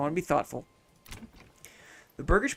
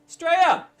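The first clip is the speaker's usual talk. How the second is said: Australia yelled even higher and louder, peaking; louder excited shout; 12 semitones higher, 7 dB louder than usual. Real, not acted. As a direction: belt out Australia at the top of the voice. Viewer writes from Australia.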